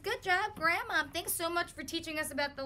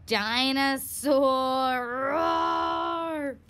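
slow, louder roaring; dinosaur roar; 5 dB louder, much slower than usual. Shown as a demonstration. Stomping like dinosaurs with the kids. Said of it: "we like to stomp like them and say".

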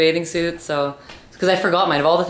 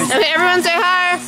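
high cheerful coaxing; 13 semitones higher than usual. Real, not acted. Mother coaxing kids in pool.

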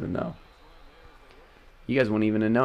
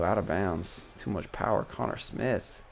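normal talking voice

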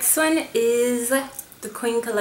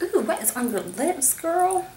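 admiring friend; 8 semitones higher than usual. Retold or imitated.

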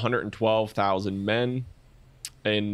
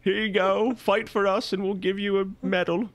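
much higher, hammed-up British accent; mock a posh British officer, badly; 11 semitones higher than usual. Host mocking the British Empire in a bad British accent. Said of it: "bad British accent".

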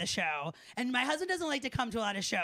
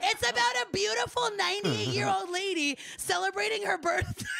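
high-pitched, comic shouted protest; indignant shrieking; 7 semitones higher, 3 dB louder than usual. Acted. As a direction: screech it in mock outrage at the commenters. Protesting the screaming complaint, acted.

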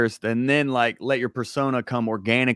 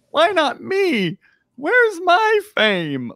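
whiny; much slower than usual. Retold or imitated. Voicing his own impatience.

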